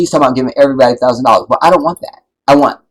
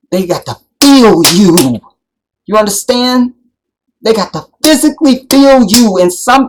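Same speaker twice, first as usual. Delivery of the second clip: louder and higher, pounding each point; preaching at full force; 7 semitones higher, 4 dB louder than usual. Real, not acted. Telling the audience to demand more, full preaching. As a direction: preach it, rising and driving hard.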